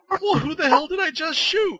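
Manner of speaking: high, loud mock shock; playing up the moment of realising the mistake; retold bafflement. Recalling shooting the wrong orc rogue, loud mock shock.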